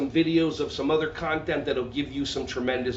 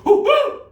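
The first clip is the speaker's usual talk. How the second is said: very high, loud monkey whoops; louder monkey noise; 9 dB louder than usual. Shown as a demonstration. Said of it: "you could be louder".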